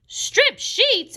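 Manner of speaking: very high-pitched, shocked exclamation; exclaiming. A narrator's reading. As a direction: squeal it in disbelief. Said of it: "exclaimed Missus Rogers".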